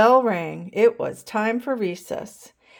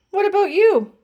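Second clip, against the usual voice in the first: teacher voice; 11 semitones higher, 7 dB louder than usual. A narrator's reading. Teacher turning to David.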